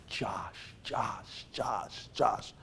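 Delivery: soft, separated repetitions; hushed echoing name. Retold or imitated. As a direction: imitate a muffled sound pulsing all around. Imitates sounds surrounding blindfolded player.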